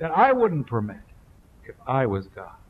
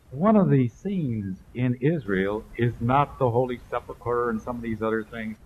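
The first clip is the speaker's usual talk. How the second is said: ordinary delivery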